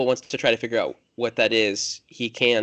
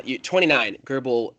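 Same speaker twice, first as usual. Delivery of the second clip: usual speaking voice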